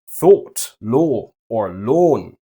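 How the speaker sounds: posh British, rounded steady vowels; very posh British accent. Shown as a demonstration. Teacher models the German O through a posh British accent. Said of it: "imitating a very posh British accent".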